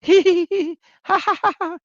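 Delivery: high-pitched mocking hee hee laugh; mocking high giggle.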